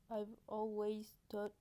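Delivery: slow, careful English with French accent. Shown as a demonstration. Vlogger showing off her English, French accent. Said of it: "observez mon bel accent anglais".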